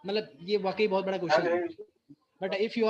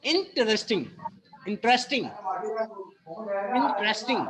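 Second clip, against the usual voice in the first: interesting said slowly with the stress on IN; word stress drill; much slower than usual. Shown as a demonstration. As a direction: model the stress of interesting for students. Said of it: "first word is the". (शब्द पर ज़ोर देकर उच्चारण)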